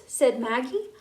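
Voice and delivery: ordinary delivery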